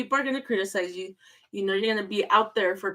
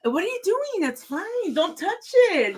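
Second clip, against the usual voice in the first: fussing; 11 semitones higher, 4 dB louder than usual. Real, not acted. Fussing at nervous husband.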